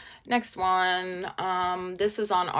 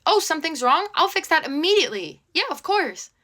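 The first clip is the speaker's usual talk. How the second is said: much higher, louder, overly chipper landlord; mock eager landlord; 11 semitones higher, 5 dB louder than usual. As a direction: play a too-helpful landlord, sarcastically. Mocking an ideal landlord.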